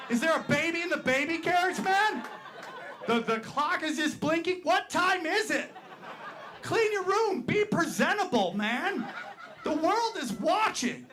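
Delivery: high, exasperated ranting at the screen; mock outrage. Comic fan scolding a porn video about its messy room, high exasperated rant.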